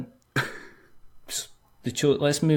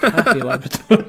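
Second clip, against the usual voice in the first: higher, louder, laughing happy label; happy Labrador; 6 semitones higher, 7 dB louder than usual. Acted. Co-host captioning pictures of a Labrador, mock happy. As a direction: say it up and loud through a laugh. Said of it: "six pictures of a Labrador".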